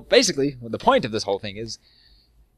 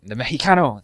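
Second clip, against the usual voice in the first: louder mock-Spanish announcement; Spanish pronunciation; 2 semitones higher, 8 dB louder than usual. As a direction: put on a Spanish accent, punched out. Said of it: "say the Mexican in Spanish".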